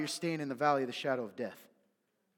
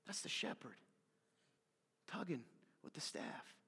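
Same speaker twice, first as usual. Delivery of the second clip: tender near-whisper; 12 dB quieter than usual. Real, not acted. Preacher softly tells the congregation God loves them, hushed. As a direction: ease down to a gentle hush. Tender turn after shouting.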